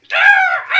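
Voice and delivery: very high, loud mock shriek; imitated scream. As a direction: scream it out in comic agony. Imitates the creature's scream, retold fight.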